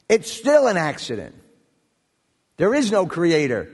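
firm, emphatic denial with arrogance. Acted. Mankind still denying God despite the evidence, arrogant insistence. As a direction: insist on it stubbornly, punching the key words. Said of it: "with more arrogance".

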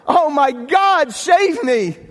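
high, loud plea for rescue; desperate cry. Acted. Atheist about to be pounced on by the bear, desperate cry. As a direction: cry out to God, drawing it out. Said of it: "cried out".

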